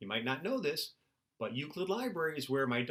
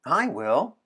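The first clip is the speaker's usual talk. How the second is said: loud, bright call to a friend; calling out cheerfully; 3 semitones higher, 7 dB louder than usual. Acted. Anna calling to William, loud and eager.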